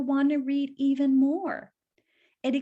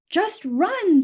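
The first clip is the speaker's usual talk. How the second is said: fox urging; 5 semitones higher, 7 dB louder than usual. Acted. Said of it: "said the fox".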